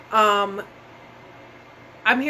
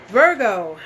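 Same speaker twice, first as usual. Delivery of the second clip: louder sign call; 6 dB louder than usual. Real, not acted. Calling out the next sign.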